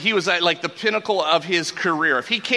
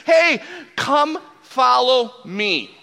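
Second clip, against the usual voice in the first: slow, louder call, higher pitched and drawn out; Jesus calling; 7 semitones higher, 6 dB louder, much slower than usual. Retold or imitated. Jesus calling to fishermen, slow and loud. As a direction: call out like a rabbi summoning followers. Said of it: "when he says".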